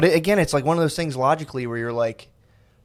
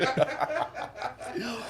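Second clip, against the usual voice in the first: high laugh; 11 semitones higher, 11 dB quieter than usual. Real, not acted. Friend teasing about hill dogging.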